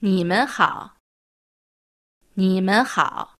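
你们好 said carefully, each tone clear; teacher demonstrating 你们好 twice for the class; model pronunciation. Shown as a demonstration. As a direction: model 你们好 for learners to repeat. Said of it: "言ってみますね".

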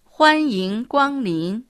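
slow, clear model pronunciation of 欢迎光臨; teacher's slow demo of 欢迎光臨; slow model phrase. Shown as a demonstration. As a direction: say 欢迎光臨 slowly for learners to copy. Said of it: "大きな声で元気よくいらっしゃいませ。".

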